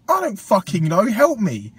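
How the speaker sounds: loud, high desperate retort; retold angry plea; exasperated. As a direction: snap back, fed up and loud. Retold quarrel with nan.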